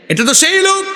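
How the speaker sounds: very high-pitched, ringing cry; impassioned exclamation. Retold or imitated. Preacher voices companions identifying a martyr, high impassioned exclamation. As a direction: cry it out in acted recognition.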